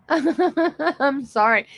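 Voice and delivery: high, slow apology through laughter; guilty laughing. Real, not acted. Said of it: "I shouldn't laugh".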